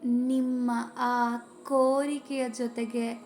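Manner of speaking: calm, even voice with long pauses; guided meditation. Acted. Leading viewers through a Mahashivaratri breathing meditation, calm guided-meditation voice. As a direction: guide listeners inward, phrase by unhurried phrase.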